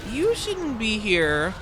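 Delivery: high, slow, cutesy warning; a sweet little ogre shooing you off; femboy Shrek. Pretend. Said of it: "We need a femboy Shrek".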